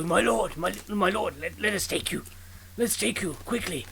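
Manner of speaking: a hint of anxious, obliging underlings; eager guards deserting. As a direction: stammer an eager offer to escort a lord. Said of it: "quickest to abandon the line".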